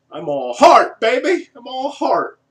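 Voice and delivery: over-the-top Jerry Maguire bravado. Pretend. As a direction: shout it like a big-screen sports agent. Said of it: "Jerry Maguire, a little of that there".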